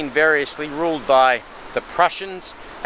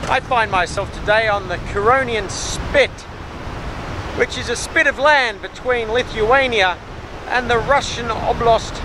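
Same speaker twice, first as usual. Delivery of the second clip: raised voice over the surf; shouting over wind; 6 semitones higher than usual. Real, not acted. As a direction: call out above the roaring wind. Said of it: "If you can hear me over the wind and the surf".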